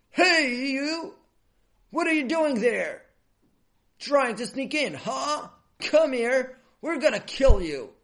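high, loud threatening shouts; angry shouting guard. A narrator's reading. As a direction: yell at an intruder caught red-handed. Guards catch and threaten the boy.

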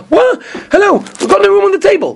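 high, acted-out indignant host; mock exasperated protest. Host turning guests away at Seder, mock exasperated protest.

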